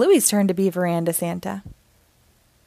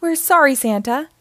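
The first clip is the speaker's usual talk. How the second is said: pleading; 6 semitones higher, 4 dB louder than usual. Acted. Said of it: "best please faces".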